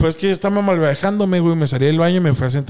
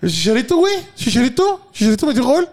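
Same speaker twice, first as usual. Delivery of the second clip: voz aguda y emocionada; emocionado; 9 semitones higher than usual. Retold or imitated. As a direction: anuncia el gol medio dormido pero emocionado. Waking excited, shouting scorer. (grita emocionado y agudo)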